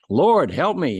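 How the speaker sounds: loud, high cry for help; a hammed-up panicked plea; desperate plea. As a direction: call out to God, hanging on. Man hanging from cliff.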